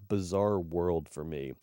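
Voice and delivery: usual speaking voice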